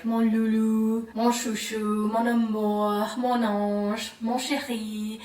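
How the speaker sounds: slow, lightly voiced tender French parent; tender pet names. French parent lovingly calling a child pet names, slow and tender. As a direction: coo the pet names slowly. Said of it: "They're always calling them".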